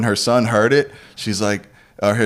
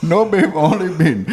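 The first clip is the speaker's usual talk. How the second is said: put-on Indian accent; 8 semitones higher than usual. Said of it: "randomly do accents".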